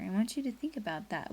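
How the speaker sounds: ordinary delivery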